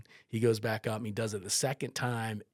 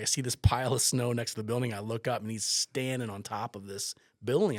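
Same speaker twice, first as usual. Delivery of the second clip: normal talking voice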